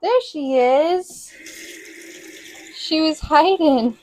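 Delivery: delighted coo at the found kitten; slow high cooing to a pet. Real, not acted. Baby talk on finding the cat.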